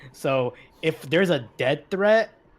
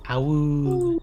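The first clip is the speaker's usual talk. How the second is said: wolf voice. Pretend. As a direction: howl the word like a wolf. Player imitates wolf howl.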